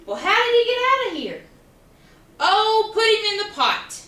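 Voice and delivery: high, quick irritated royal order; a hint of a fed-up king; frustrated king. The king sending Quackling to the pot.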